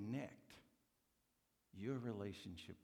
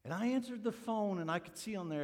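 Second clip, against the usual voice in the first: excited; 11 semitones higher, 9 dB louder than usual. Retold or imitated. Excited retelling of phone call.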